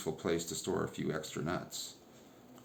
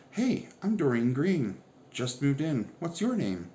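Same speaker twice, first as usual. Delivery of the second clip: eager greeting; 7 semitones higher, 4 dB louder than usual. A narrator's reading. Doreen greeting new girl.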